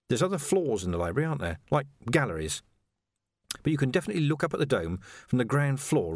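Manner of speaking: ordinary delivery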